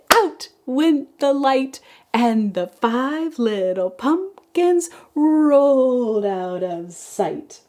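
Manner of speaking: slow, sing-song poem reading; kindergarten teacher voice. Acted. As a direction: read it like circle time. Reads a poem to children.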